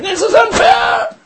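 high, loud whining scream of protest; hammed-up shouting fit; mock scream. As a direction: scream it like a nut on the street. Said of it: "screaming his head off saying".

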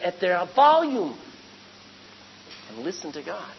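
usual speaking voice

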